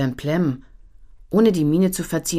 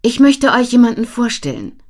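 higher, louder teacher voice; 9 semitones higher, 9 dB louder than usual. A narrator's reading. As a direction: address the class as the teacher.

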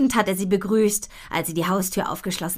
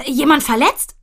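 startled; 6 semitones higher, 6 dB louder than usual. Acted. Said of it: "Erschrocken steckt Paps seinen Kopf".

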